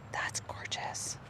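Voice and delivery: hushed, breathless whisper of awe. Real, not acted. Alone among flowers, whispering in awe. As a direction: whisper it like nobody should hear. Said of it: "I don't know why I'm whispering".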